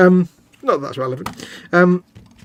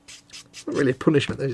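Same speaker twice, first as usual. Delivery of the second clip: ordinary talk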